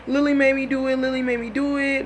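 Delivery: high, whiny pleading; hammed-up tearful begging; mock bawling. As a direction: imitate a man blubbering out excuses.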